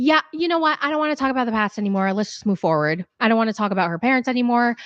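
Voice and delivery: hurried dismissive retort; rushed mock Forrest. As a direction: rattle it off to end the topic.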